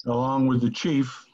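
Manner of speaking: ordinary talk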